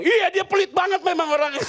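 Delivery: mock agreement. Pastor agreeing with his brother that the host is stingy. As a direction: pile on the host with a squeaky yes.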